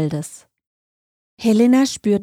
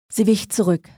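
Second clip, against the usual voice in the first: ordinary delivery, nothing marked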